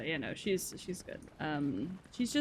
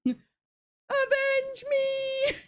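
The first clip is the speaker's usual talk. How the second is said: high, drawn-out dying hero plea; dramatic cry; 4 dB louder, much slower than usual. Pretend. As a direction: wail it like a fallen warrior. Dramatic death cry in game.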